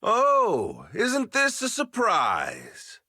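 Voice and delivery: slow, sneering fake surprise; mocking surprise. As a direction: drawl it with mockery. Villain's mocking entrance.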